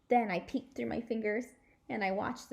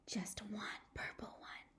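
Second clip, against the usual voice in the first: awed whisper; hushed, amazed whisper; 15 dB quieter than usual. Acted. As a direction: breathe it in wonder. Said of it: "made a hushy sound together".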